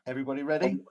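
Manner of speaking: grinning as he asks; a smile you can hear; smiling. Real, not acted. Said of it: "I can hear the smile in [name]'s voice".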